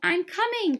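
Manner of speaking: high, loud duckling call; crying out. A narrator's reading. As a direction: shout back as a little duckling. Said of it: "cried the ugly duckling".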